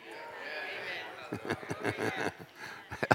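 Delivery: soft, long pulsing chuckle; chuckling. Real, not acted. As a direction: laugh softly at your own remark. Preacher laughs after his quip.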